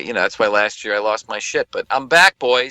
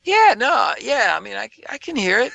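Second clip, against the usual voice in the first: unimpressed partygoer; 11 semitones higher than usual. Retold or imitated. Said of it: "they'll just kinda go".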